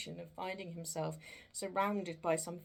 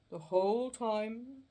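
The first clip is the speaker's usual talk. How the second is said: ghost voice; 5 semitones higher, 6 dB louder than usual. Acted. Ghost answers Scrooge.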